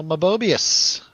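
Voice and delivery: ordinary delivery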